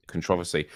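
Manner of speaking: "Controversy" as con-TROV-ersy; stress on second syllable. Shown as a demonstration. Guest shows how others say it, con-TROV-ersy. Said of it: "I hear some people say controversy".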